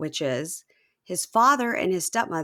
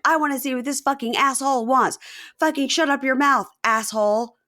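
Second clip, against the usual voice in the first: high, aggressive mock rant; [name] yelling; 7 semitones higher, 3 dB louder than usual. Man yelling at the camera about an opponent, mock anger. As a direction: imitate him cursing out the camera. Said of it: "He's yelling at the camera".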